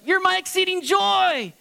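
high, loud, drawn-out joyful cry; acted burst of jubilant worship; exuberant praise. Acts psalmist's exuberant praise.

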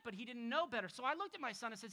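normal talking voice

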